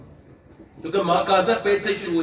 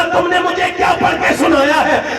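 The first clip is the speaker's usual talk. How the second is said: high, loud, urgent question; acted amazed husband; 9 semitones higher, 6 dB louder than usual. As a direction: cry out in wonder as the cured husband. Cured husband questions wife in amazement. (ठीक हुए पति का हैरत भरा ऊँचा सवाल)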